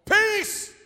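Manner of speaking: high, loud shouted commands; commanding the storm. Acted. Jesus commanding wind and waves, loud shout. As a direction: order the wind to stop. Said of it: "he turned around and said".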